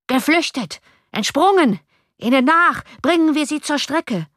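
high-pitched, urgent crowd cries; cursing mob shouts. A narrator's reading. Crowd in the street cursing the fugitives, angry high shouting. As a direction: rouse the mob to the chase. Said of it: "wiederholte unter grässlichen Verwünschungen".

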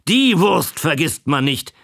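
lightly voiced old man calling out his pride; called out. A narrator's reading. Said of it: "rief er".

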